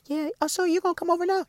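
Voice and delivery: put-on high, quick, soft girly voice; girlish Tina voice. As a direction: play the sister sweet-talking her boyfriend. Voicing Tina on the phone.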